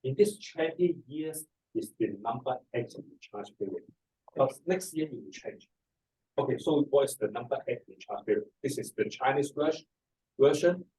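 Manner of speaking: ordinary talk, nothing marked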